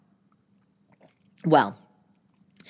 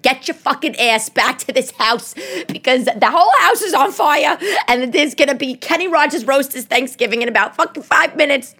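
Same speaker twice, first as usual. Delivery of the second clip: higher, urgent furious demand; frantic angry yell; 8 semitones higher, 4 dB louder than usual. Retold or imitated. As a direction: bark at him to get back now. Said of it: "that's when I called my boyfriend".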